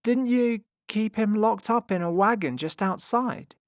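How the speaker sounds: high, loud, unsure questioning. A narrator's reading. James questions the circus folk, sounding unsure. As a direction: ask it high-pitched, doubting yourself. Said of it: "starting to sound a little unsure".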